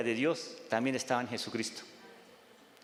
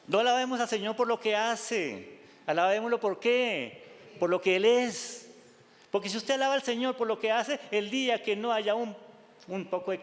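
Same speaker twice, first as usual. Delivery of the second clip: fervent pulpit exhortation; preaching at full force; 8 semitones higher than usual. Acted. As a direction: press the point home to the flock.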